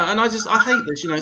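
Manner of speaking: ordinary delivery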